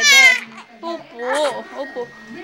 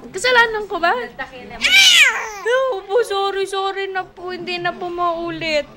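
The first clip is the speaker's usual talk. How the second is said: high, pretend-contrite pleading; mock apology; 4 semitones higher, 3 dB louder than usual. Parent apologises to shouting baby, mock apology. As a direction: beg pardon playfully, starting high and easing down.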